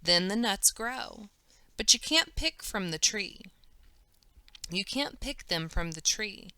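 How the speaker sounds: plain reading voice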